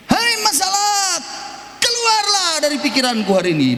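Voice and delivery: high crying command, settling at the end; tearful shout. Shown as a demonstration. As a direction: cry out for freedom. (teriakan sambil menangis)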